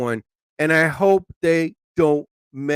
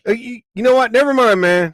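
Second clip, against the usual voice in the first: loud, high fed-up snap; telling the drive-thru worker never mind; exasperated; 7 semitones higher, 5 dB louder than usual. Retold or imitated. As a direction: give up on them, irritated. Giving up on clerk.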